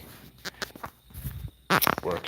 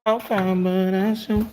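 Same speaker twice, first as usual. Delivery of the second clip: put-on baby talk; 13 semitones higher, 4 dB louder, slower than usual. High baby-talk to dogs.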